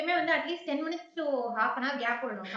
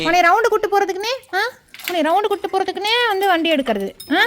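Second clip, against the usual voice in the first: loud, high-pitched nagging; raised-voice rhetorical complaint; exasperated scolding; 6 semitones higher, 12 dB louder than usual. Real, not acted. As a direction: tell off a demanding pet. Scolding dog wanting a ride.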